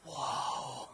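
put-on whispered child's amazement; awed whisper. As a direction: breathe out a whispered wow. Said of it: "I just remember going".

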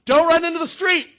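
put-on sharp shouted warning at a child; loud warning shout. Shown as a demonstration. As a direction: yell it like a child is near the street. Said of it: "That's the kind of warning".

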